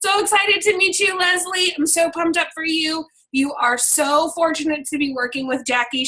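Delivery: high, gushing welcome, easing down gradually; excited voice message. Acted. Coach recording a welcome message for new promoter Leslie, excited. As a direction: greet a newcomer with pumped-up delight. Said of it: "This is me doing my voice recorder".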